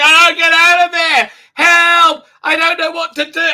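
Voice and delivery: high, loud mock panic; hammed-up frantic pleading; captain crying for help in mock panic; panicked cry for help.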